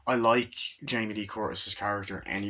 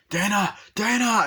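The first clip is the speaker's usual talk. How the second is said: high, loud imitated call; 11 semitones higher, 7 dB louder than usual. Father calls after runaway Dana, imitated shout. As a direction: shout after her, as retold.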